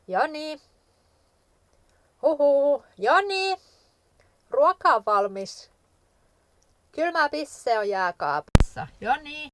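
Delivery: playful high calls for someone out of earshot; calling out. Pretend. Calling absent Joni in to food, pretend calling out.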